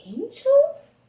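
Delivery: plain reading voice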